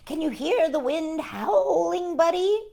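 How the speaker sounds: high, slow call over the storm; mild mock cry; cried out. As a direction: call out over the howling wind. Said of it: "Earl cried".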